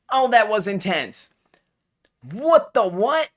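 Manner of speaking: normal talking voice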